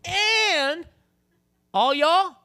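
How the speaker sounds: high, loud taunting shout; bold shout. Acted. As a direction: call out the whole army defiantly. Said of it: "And then he said".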